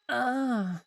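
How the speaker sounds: short, high, unbothered little ah; mock a mildly annoyed gasp, no real fright; mildly miffed ah. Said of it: "she's just a bit miffed".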